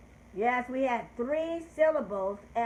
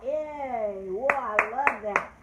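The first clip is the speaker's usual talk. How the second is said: usual speaking voice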